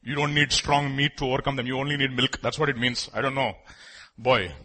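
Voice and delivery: usual speaking voice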